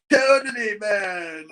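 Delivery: a hint of a laid-back surfer dude. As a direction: drop into a light surfer-dude drawl. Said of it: "trying to be like a surfer".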